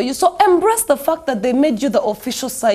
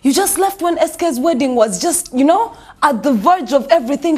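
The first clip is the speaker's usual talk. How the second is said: usual speaking voice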